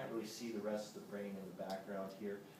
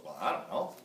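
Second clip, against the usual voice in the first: doubtful audience; 4 semitones lower, 7 dB louder than usual. Retold or imitated. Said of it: "And everyone goes".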